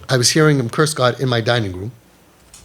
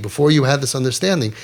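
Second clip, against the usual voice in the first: ordinary delivery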